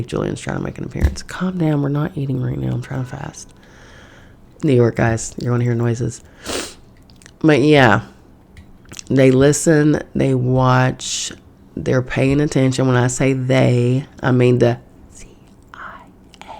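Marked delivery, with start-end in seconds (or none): 1.2-3.5 soft hushing whisper; whispered aside. Real, not acted. Telling her rumbling stomach to calm down, whispered. 15.1-16.5 hushed unvoiced whisper. Real, not acted. Naming the CIA to viewers, secretive whisper. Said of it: "I'm whispering it because".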